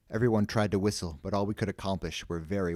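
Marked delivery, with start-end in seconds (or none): none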